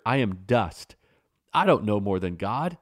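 usual speaking voice

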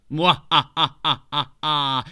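hammed-up villain cackle; evil laugh. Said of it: "he laughed".

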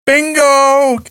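high, drawn-out caller's cry; imitated bingo call. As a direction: call it out slowly across a hall. Retold bingo call breaks into promo.

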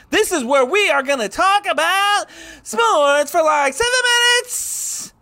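cracking high-pitched shout; yelling the intro way up high. Pretend. Hammed up show intro.

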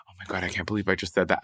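soft, rushed mutter under the breath; low, hurried self-reproach; embarrassed aside. Acted. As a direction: blurt it quickly and quietly to yourself. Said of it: "and then is like".

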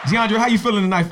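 high, loud hype greeting; hyped greeting. Acted. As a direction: pump up the guest's welcome.

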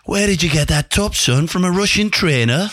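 imitated manager's accent, pitched high; high-voiced impression of the old boss's accent; Sir Bobby. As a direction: quiz the lad in the gaffer's accent, high and curious. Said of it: "I'll do his accent".

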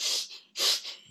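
quick voiceless in-and-out breath; hurried breathing. Shown as a demonstration. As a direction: imitate breathing straight through without a pause. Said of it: "between the inhale and the exhale".

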